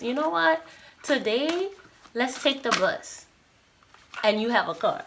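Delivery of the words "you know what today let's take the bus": higher, bright sudden suggestion; spontaneous inner voice. Retold or imitated. Intuition nudging the listener to take the bus, higher playful voice. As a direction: pitch it up like a whim popping into your head. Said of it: "it can say".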